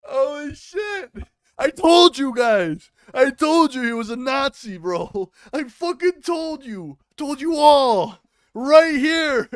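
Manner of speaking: high, loud, drawn-out shouts; triumphant I-told-you-so yelling; gleeful vindication. Real, not acted. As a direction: crow it loudly, stretching the words. Vindicated, reacting to the clip.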